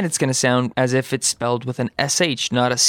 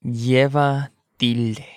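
slow model; 3 semitones lower, slower than usual. Shown as a demonstration. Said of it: "slow model for learners".

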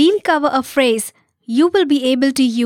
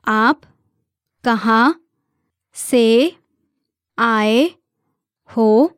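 word by word. Shown as a demonstration. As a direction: give each word on its own for learners. Teacher breaks question down for learners.

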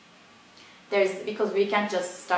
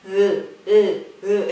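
laughing; slower than usual. Real, not acted. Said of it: "Try to make that sound".

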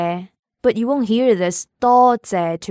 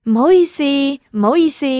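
urgent apology; 6 semitones higher, 3 dB louder, much faster than usual. Pretend. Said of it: "if we wanted to say, "I'm sorry, I'm sorry".

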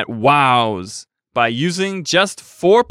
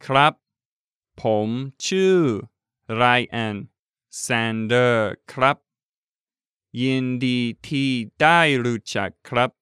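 slow, syllable by syllable; over enunciating each word; slowly; 4 semitones lower, much faster than usual. Shown as a demonstration. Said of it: "one more time, slowly".

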